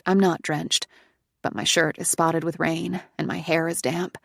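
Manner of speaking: ordinary delivery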